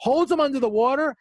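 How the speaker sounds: ordinary talk, nothing marked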